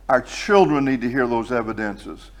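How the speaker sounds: ordinary talk, nothing marked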